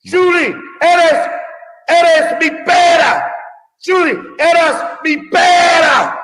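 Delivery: loud high slow yell; shouted Spanish gag. Acted. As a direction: bellow it out, drawn out.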